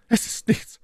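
high, airy voice for the lady; Milady's voice. A narrator's reading. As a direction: answer in the lady's high register. Versetzte My Lady. (hohe Stimme der Mylady)

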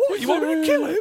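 high, hammed-up tough-guy question; mocking a bad Statham; a hint of a movie hardman; mock Statham impression. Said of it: "I love your impersonation of Statham".

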